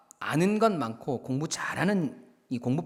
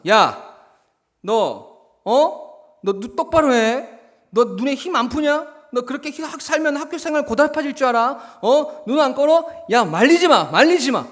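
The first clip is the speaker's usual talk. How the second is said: loud, high-pitched blustering threats; face-saving anger; 10 semitones higher, 10 dB louder than usual. Acted. Humiliated bully yelling back at the new student, angry. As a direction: pick a fight loudly as if being held back. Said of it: "그렇게 더 화를 내는 거죠".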